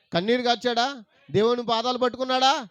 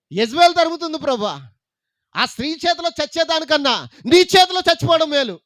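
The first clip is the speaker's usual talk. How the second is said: raised, anguished plea; despairing Elijah; 9 semitones higher, 9 dB louder than usual. Retold or imitated. Preacher voicing Elijah begging God for death. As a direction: beg God to end it.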